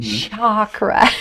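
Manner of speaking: "chakra" as SHAH-kra; popular pronunciation. Shown as a demonstration. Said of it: "that's the popular way".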